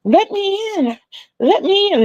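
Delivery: high squeaky bug voice begging to come in; a tiny pest whining at the door; pleading bugs. Pretend. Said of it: "they're sitting at the door like".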